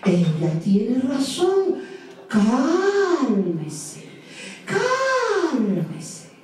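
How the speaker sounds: voz aguda y lenta de tortuga; tortuga sabia. A narrator's reading. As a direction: aconseja despacio y con calma. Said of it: "y le dijo". (doña Tortuga aconseja al elefante, voz aguda y pausada)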